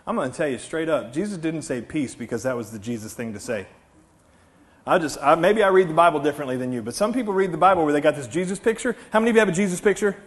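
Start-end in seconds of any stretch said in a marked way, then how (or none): none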